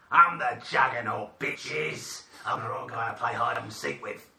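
a slight Australian twang, Juggernaut line; lightly Australian tough-guy boast; accidental Australian accent. Acted. Quiz quote slipping into a mild Australian accent. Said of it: "did that Australian".

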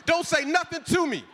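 high, loud, furious shout; angry yell. Retold or imitated. Dad shouting at daughter while fighting the dog, furious. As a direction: bark it at the back-seat driver.

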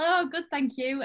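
usual speaking voice

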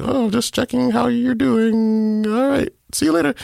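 slow, drawn-out, high imitation of a friend; evasive casual. Friend dodging bad news on the phone, evasively casual. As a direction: stretch it out, awkwardly breezy and high.

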